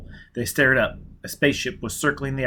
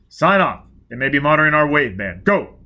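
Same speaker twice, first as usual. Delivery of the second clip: urgent order; 3 semitones higher, 7 dB louder than usual. Acted.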